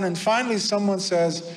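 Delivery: ordinary talk, nothing marked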